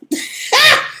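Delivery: a quick self-amused giggle; embarrassed little laugh; high breathy laugh. Real, not acted. Laughs at own mispronunciation.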